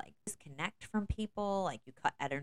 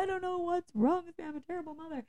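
despairing cry; 13 semitones higher, faster than usual. Retold or imitated. Mother to her kids after a meltdown, despairing cry. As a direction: cry out feeling like a failure.

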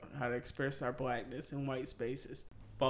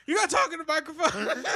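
high, laughing snappy jab; 8 dB louder than usual. Retold or imitated. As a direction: snap it while laughing. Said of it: "the exact quote was, Snappy".

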